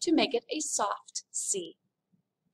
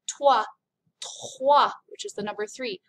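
ordinary delivery

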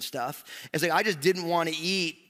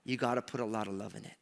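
softer, tender and knowing; smiling grandma; 3 semitones lower, 7 dB quieter than usual. Retold or imitated. Grandma gently reveals the secret ingredient, tender. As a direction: let the smile into the voice, soft. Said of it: "she got a big smile on her face".

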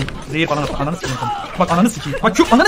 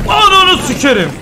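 angry shout; 12 semitones higher, 7 dB louder than usual. Real, not acted. Frustrated gamer yelling at game.

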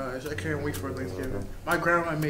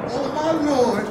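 panicked; 10 semitones higher, 9 dB louder, slower than usual. Acted. Panic after scream.

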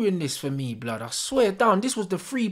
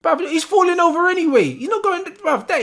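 loud, high, fast furious rant; angry outburst; 11 semitones higher, 8 dB louder, faster than usual. Real, not acted. Fan fuming at a foul on TV, loud angry outburst. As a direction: blow up at the screen in disbelief. Fan raging at match footage.

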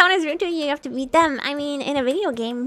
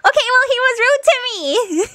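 higher pitch, giggling as the words come out; laughing; 5 semitones higher, 4 dB louder than usual. Real, not acted. Player excusing an attack on a game character, laughing. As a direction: talk through real giggles.

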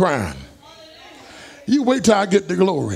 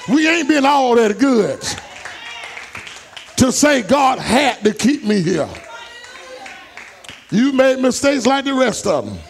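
preaching at full force; 3 semitones higher than usual. Acted. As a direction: preach it out, building the fire. Preacher shouting to congregation.

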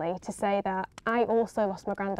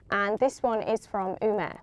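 usual speaking voice

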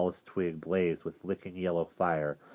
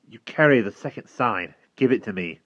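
put-on commanding boy; 4 semitones higher, 8 dB louder than usual. Will demands the sign.

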